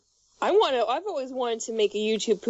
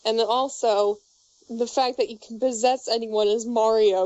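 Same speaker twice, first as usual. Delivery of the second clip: usual speaking voice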